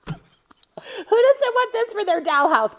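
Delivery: high, slow, laughing mock pitch; laughing joke. Real, not acted. To her viewers, joking about tiny cat litter, high and laughing. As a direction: sell the joke through giggles.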